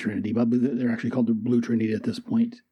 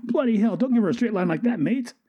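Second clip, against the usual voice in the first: put-on high, fast exasperated Australian accent; high-pitched Aussie exasperation; 8 semitones higher than usual. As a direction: blurt it out in protest, Australian. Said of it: "Boomerang's like".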